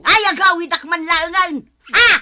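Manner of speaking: loud, high offended exclamation; indignant shout; offended outburst. Acted. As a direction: storm off in a huff. Offended neighbour storms off.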